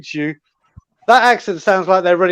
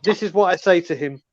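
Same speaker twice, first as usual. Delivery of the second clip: usual speaking voice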